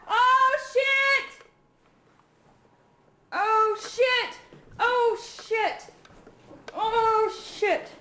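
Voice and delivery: repeated high startled cries; panicked. Real, not acted. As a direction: yelp at each swoop. Bird flying about, panic.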